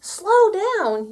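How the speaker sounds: high loud alarmed yell; panicked yell. A narrator's reading. Minnie yelling at Moo on the runaway tractor, alarmed. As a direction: yell for it to stop. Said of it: "yelled Minnie".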